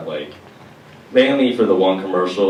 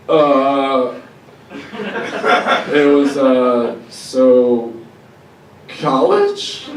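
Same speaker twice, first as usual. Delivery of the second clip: higher, slow, laughing mock voice; hammed-up dumb college guy; clueless stoner; 6 semitones higher, much slower than usual. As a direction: stall and giggle like a dazed partier. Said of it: "was probably just like".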